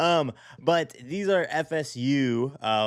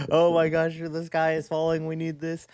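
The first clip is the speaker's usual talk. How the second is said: panicky client; 6 semitones higher than usual. Retold or imitated. Client panicking over urgent project.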